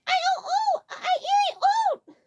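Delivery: very high, halting gasps; panicked breathless stammer; hyperventilating. A narrator's reading. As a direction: gasp out broken words. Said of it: "began hyperventilating".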